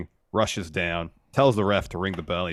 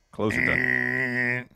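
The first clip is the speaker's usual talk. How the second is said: slow, drawn-out game show call; game show host; 5 semitones higher, much slower than usual. Pretend. Hosts joking like a game show, slow host call. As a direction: announce it like a quiz host.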